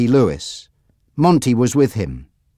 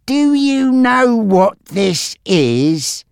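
high, very slow, drawn-out question; menacing gangster Frankie; 10 semitones higher, much slower than usual. Acted. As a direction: taunt the captive, stretching each word.

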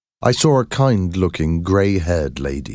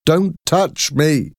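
slow, much higher cry; desperate shout; 10 semitones higher, slower than usual. A narrator's reading. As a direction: fight them off with your voice. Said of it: "I tried to shout".

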